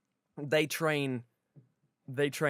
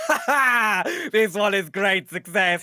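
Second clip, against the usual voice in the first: loud, slow hammed-up Russian trainer; booming comic Russian boast, raised pitch; Russian accent; 3 semitones higher, 5 dB louder, slower than usual. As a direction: crow triumphantly in a mock Russian accent. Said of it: "'cause he's a Russian man".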